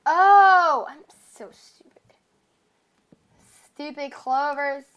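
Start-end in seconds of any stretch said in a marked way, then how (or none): 0.0-0.9 frustrated shout. Real, not acted. Frustrated exclamation. 0.9-1.9 whispering. Real, not acted. Self-scolding aside.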